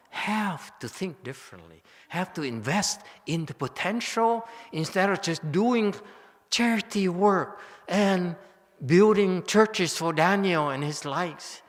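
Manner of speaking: worked-up monk urging the crowd; excited preaching. Real, not acted. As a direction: rise up and press the point hard. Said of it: "I get very emotional and very excited".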